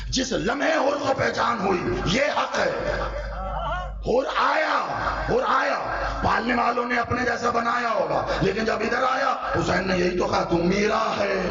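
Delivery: preaching at full force. Acted. Preacher crying out Hurr's story to the gathering, full force. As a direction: rise to a fervent shout.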